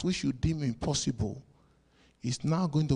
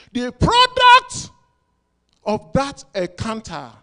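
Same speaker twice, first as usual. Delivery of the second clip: much higher, louder, slow; soaring preaching shout; 10 semitones higher, 14 dB louder, slower than usual. Acted. Preacher shouting a slow word to his congregation. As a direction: cry out the word.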